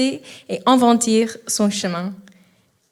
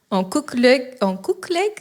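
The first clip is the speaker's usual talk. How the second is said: puzzled query; 2 semitones higher than usual. Real, not acted. Presenter stumbling on a typo in the bio, puzzled query. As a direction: read it back as a baffled question.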